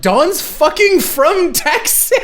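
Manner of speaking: exasperated outburst. Retold or imitated. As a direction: yell it in disbelief. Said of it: "I'm like".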